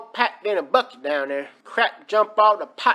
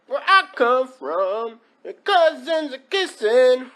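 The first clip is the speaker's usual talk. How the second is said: ordinary talk, nothing marked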